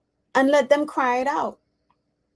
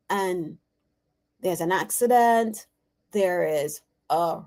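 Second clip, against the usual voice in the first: ordinary talk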